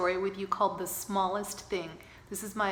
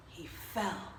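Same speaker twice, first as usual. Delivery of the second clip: plain reading voice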